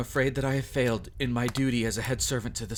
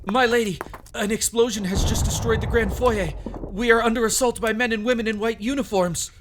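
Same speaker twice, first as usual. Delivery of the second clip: high, loud urgent report; urgent alarm; 9 semitones higher, 5 dB louder than usual. Acted. Servant shouting the attack report to lady.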